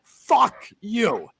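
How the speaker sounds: high, loud furious rant; slow, heated insults; real anger. Real, not acted. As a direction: spit it out in rising anger. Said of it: "I'm getting mad now".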